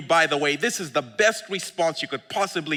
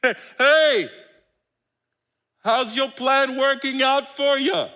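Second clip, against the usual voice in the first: playful needling call; loud, higher playful taunt; teasing Jesus; 8 semitones higher, 4 dB louder, slower than usual. Acted. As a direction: rib them like an old friend. Preacher voicing Jesus teasing.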